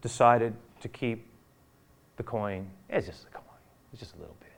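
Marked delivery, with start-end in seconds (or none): 2.9-4.5 quiet inner voice. Retold or imitated. Judas voiced tempting himself.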